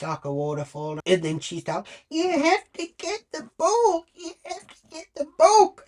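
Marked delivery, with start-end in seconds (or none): 2.1-5.8 much higher, whiny mock sob of a little girl; hammed-up tearful daughter begging; mock crying child. Imitating the crying daughter begging mum for the book. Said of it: "crying, tears rolling down her face".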